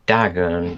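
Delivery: Dagon said DAG-un, short a and weak second vowel; show's pronunciation. Shown as a demonstration. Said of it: "they pronounce it".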